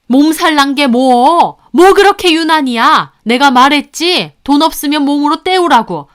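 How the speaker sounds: high, loud dismissive scolding; angry husband. A narrator's reading. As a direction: berate the wife, brushing off the complaint. Husband berates sick wife. (언성 높여 다그치는 남편)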